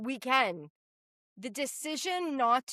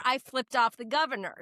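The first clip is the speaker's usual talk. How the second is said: usual speaking voice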